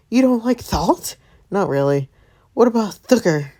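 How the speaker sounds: high, bright granny voice; sweet old lady voice. Acted. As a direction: play a sweet grandma. Voicing grandma character.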